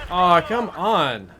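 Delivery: exasperated and stretched out, pitch up; real gamer frustration, dragged out slowly. Real, not acted. Losing repeatedly in the game.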